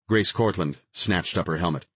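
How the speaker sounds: ordinary delivery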